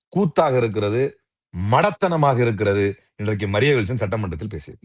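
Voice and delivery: usual speaking voice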